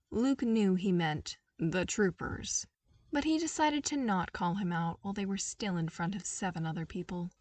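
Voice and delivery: plain reading voice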